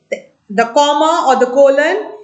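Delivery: usual speaking voice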